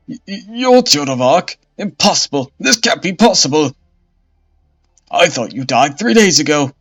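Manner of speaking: loud, high, stuttering panic; stammering terror. Acted. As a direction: babble in horror. Bishop faces the dead witch.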